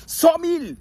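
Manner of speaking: high, loud, slow game show call; hammed-up quiz announcer; quiz host call. Shown as a demonstration. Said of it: "je fais un quiz".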